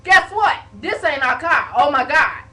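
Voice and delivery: sudden loud alarmed outburst; shocked realization. Real, not acted. Sister realizing to her brother they broke into the wrong car, loud shock. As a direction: blurt it out as it dawns on you.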